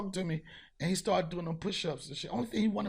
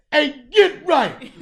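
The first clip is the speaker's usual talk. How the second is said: "Hey, Get Right" called much higher and louder, drawn out slowly; with your chest; 8 semitones higher, 14 dB louder, much slower than usual. Shown as a demonstration. The right way to hail Get Right, loud and slow. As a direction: stretch "Hey, Get Right" out big and proud, laughing. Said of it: "You gotta say".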